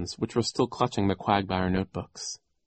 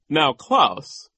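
raised, higher reproving voice; stern; 6 semitones higher, 6 dB louder than usual. A narrator's reading. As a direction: admonish the boy. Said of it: "Mister Poe said sternly".

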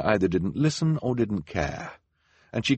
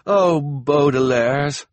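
very slow, raised, tearful sigh of relief; 8 semitones higher, 8 dB louder, much slower than usual. A narrator's reading. Jacques turning tearfully to the three siblings, slow. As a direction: let the relief break through tears. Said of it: "had tears in his eyes".

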